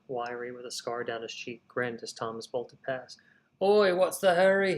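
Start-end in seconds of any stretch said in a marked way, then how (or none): 3.6-4.8 loud, higher mocking call of a scarred thug; a grinning street tough shouts after him. A narrator's reading. Wiry scarred man calls out teasingly to the runner. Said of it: "he called".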